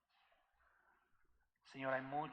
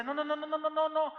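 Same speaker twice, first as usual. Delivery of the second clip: frantic high shouted noes, much louder; panicked denial; 13 semitones higher, 14 dB louder than usual. Retold or imitated. Deacon panics at robbery accusation. (negación a gritos, desesperada y muy aguda)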